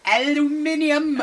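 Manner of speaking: aluminium said high, British five syllables; British pronunciation. Retold or imitated. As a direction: echo aluminium as al-yoo-MIN-ee-um. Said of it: "east side of the planet".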